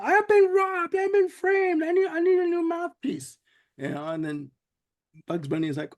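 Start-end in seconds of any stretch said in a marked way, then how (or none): 0.0-3.4 very high, strained gangster panic; Finster freaking out. Retold or imitated. Jailed Finster panics in his playpen, very high put-on squeal. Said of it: "he's, like, freaking out".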